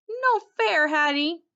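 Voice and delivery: dog protesting to Hattie climbing the tree, barking; high, loud barked protest. Acted. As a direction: bark it out in protest. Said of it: "I bark".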